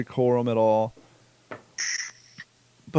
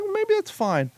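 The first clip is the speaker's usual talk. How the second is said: much higher, airy mock reasoning; self-quote. Angler mocking his own store decision, high-pitched. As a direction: talk yourself into it in a high voice.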